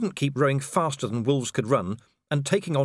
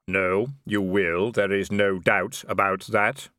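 slow, low, otherworldly Death; drawn-out reaper musing; grim reaper voice; 3 semitones lower, slower than usual. Acted. Death speaking.

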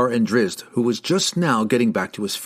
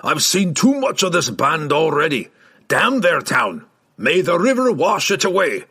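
higher, roaring dwarf curse; angry outburst; 6 semitones higher, 3 dB louder than usual. A narrator's reading. Dwarf curses the riders' town, angry roar. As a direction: curse them in an exasperated dwarf voice.

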